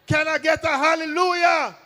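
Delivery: shouted high, like a preacher at full force. Shown as a demonstration. Comedian shouts to the crowd like a preacher. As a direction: whip the crowd up with it.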